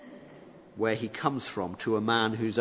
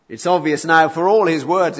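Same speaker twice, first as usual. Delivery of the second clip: louder, higher emphatic declaration; forceful preaching; 5 semitones higher, 11 dB louder than usual. Real, not acted. Preacher to congregation, loud emphatic declaration. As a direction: drive the point home hard.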